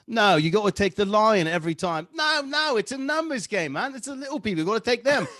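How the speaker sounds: put-on playful English bloke accent, pitched high; joking around in a put-on English accent. Joking with Gary in an ad break, English accent. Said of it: "You can only talk like that from now on".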